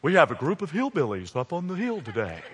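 measured news anchor impression; Wolf Blitzer. Anchor reporting on hillbillies in Washington, mock newscast delivery. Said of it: "can you imagine Wolf Blitzer".

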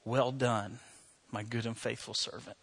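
hushed retelling of a tearful wish; tearful longing. Retold or imitated. Dying doctor confiding her last hope to a friend, softly tearful. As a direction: let it fade softly, as if moved to tears. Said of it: "she just started crying".

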